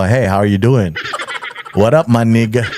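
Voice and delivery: low, put-on American phone-pal accent; lightly faked American slang accent. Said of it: "iis lang ako". (kunwaring puntong Amerikano, mababa ang boses)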